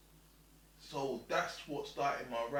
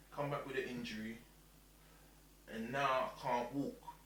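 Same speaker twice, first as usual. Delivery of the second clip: ordinary talk, nothing marked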